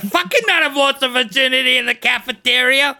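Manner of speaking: loud, high mock outrage; hammed-up fatherly rant at full volume; angry dad. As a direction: play a furious father laying down the law. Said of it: "Your dad, if he listens to this".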